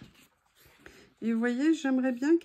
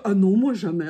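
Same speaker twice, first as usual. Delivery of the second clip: mock denial; 4 semitones lower, 8 dB louder than usual. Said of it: "quelqu'un qui ose me dire".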